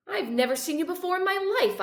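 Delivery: high, loud indignant protest; blustering. Acted. Boy protests to the stranger calling herself Mom, loud and indignant. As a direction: push back hard, flustered. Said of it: "I bluster".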